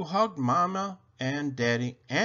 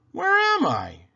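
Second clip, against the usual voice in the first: much higher, louder astonished cry; wide-eyed gasping question; amazed boy; 6 dB louder than usual. Acted.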